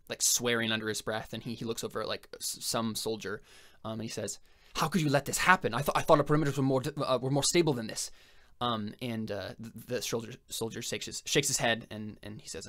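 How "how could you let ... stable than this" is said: higher, louder, rushed scolding; angry Haitian boss. Acted. Haitian berating the soldier about the breach, angry. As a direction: tear into the soldier, fast and raised.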